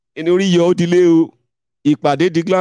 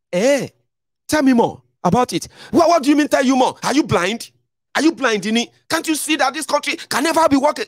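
incredulous friend; 11 semitones higher than usual. Retold or imitated. Friend scolding Dini for not seeing: high, incredulous. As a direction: play the friend snapping at him.

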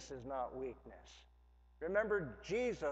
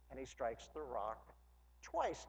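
normal talking voice

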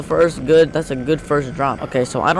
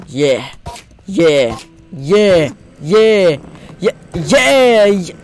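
repeated cheer climbing from normal to high and loud; building triumph; 4 semitones higher, 6 dB louder, much slower than usual. Real, not acted. Player landing hit after hit, mounting triumph. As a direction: build each one bigger.

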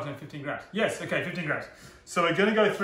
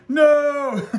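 surprised laughing no; 12 semitones higher, 13 dB louder than usual. Real, not acted. Recipe step goes wrong.

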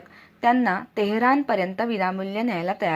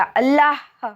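loud, higher-pitched cry; commanding shout; 6 semitones higher, 5 dB louder, much slower than usual. A narrator's reading. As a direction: shout it as a demand. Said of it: "पुन्हा बोट दाखवीत तो ओरडला". (जोरात, चढ्या आवाजात ओरडून)

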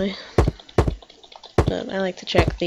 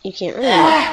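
cracking up mid-line, louder; laughing through the line; 4 semitones higher, 6 dB louder, slower than usual. Real, not acted. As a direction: giggle through the words. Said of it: "Stop, stop".

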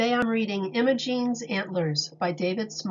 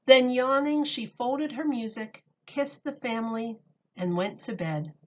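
calm storybook reading voice; reading to children; 3 dB louder than usual. A narrator's reading. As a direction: read it to a class of little kids. Reads picture book aloud.

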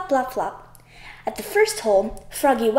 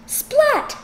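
splat sound. Acted. Food slips and falls.

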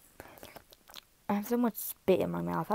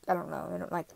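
ordinary talk, nothing marked

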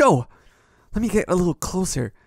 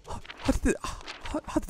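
voiceless, faltering murmur; falter, lost for words; stunned whisper; 6 semitones higher, 13 dB quieter than usual. Acted. Hero finding the map empty, stunned whisper.

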